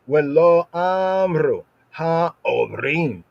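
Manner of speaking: "Ha, Obrim" drawn out slowly with a throaty ayin on "Obrim"; emphasized ayin. Shown as a demonstration. Teacher stressing the hard sound for students, slow and deliberate. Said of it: "I just emphasize the ayin there".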